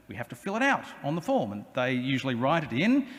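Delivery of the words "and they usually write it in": usual speaking voice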